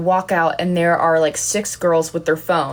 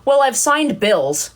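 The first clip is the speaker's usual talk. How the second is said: proud claim; 7 semitones higher, slower than usual. Retold or imitated. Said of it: "I said".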